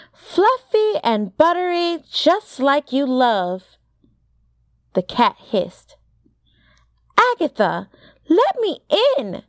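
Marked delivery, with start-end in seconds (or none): 0.1-3.8 high-pitched, tempting singsong; coaxing. A narrator's reading. Sophie tempts friend with biscuits. 7.2-9.4 high-pitched, insistent demand; urgent plea. A narrator's reading. Sophie pleads at the door.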